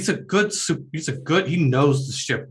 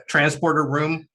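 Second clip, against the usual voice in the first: usual speaking voice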